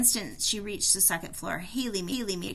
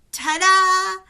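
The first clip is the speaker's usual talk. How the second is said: much higher and louder fanfare cry; triumphant ta-da; 10 semitones higher, 14 dB louder than usual. Acted. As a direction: announce the big reveal. Triumphant reveal.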